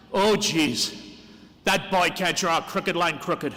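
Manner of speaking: light Irish accent, lovingly imitating his mother; a hint of a thick Irish accent, teasing. Son mimics his Irish mother judging his drawing, in a mild Irish accent. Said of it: "who is from Ireland and has a thick accent".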